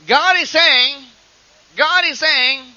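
imitated scholar's prophecy, high and projected; philosophy doctor prophesying. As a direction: declare God's message as a learned man would. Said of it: "by a doctor of philosophy".

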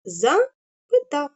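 «Запитав» said slowly, syllables apart; slow syllable split. Shown as a demonstration. Teacher demonstrating the hyphenation to children. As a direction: show how «Запитав» splits. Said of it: "Я хочу перенести так".

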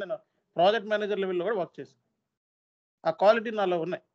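normal talking voice

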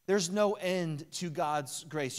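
ordinary delivery

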